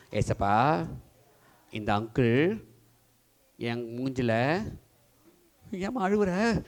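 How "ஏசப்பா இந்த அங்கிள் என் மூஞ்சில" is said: put-on slow, lower, praying as the little girl; child's prayer. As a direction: pray it slowly for the child. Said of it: "அதுக்கு முன்னாடி சொல்லு". (குழந்தையின் மெதுவான ஜெபம்)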